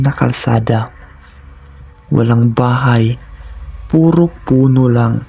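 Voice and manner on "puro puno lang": ordinary delivery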